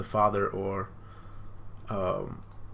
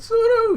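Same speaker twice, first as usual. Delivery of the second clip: piercing high bird screech, full volume; loud bird cry; 11 dB louder than usual. Retold or imitated. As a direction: shriek it like a diving bird. Bird's battle cry.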